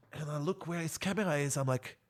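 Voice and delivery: ordinary talk, nothing marked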